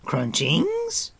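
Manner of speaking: eager creature hoping for food; Gurgi, brightened. A narrator's reading. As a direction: perk up, sliding high at the end. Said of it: "Gurgi brightened".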